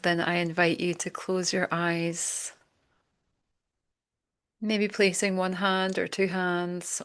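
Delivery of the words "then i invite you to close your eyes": guided meditation. Acted. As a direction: gently lead them inward, leaving room to breathe. Leads a breathing meditation.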